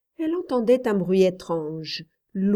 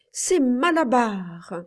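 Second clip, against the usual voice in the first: slow and emphatic; powerful yell; 4 semitones higher, 3 dB louder, slower than usual. A narrator's reading. Boy on his rocking horse, yelling it out slowly. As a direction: drive the name home one more time. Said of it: "hurla-t-il d'une voix étrangement puissante".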